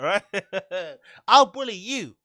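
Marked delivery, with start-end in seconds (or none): 1.3-2.2 childish mimic. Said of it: "His legs swinging back and forth as he's saying that".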